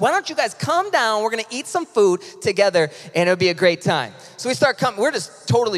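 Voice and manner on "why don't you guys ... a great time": higher-pitched, excited lady making an announcement; loudspeaker announcement. Retold or imitated. Lady calling teachers down to lunch, imitated excited announcement. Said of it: "went over the loudspeaker".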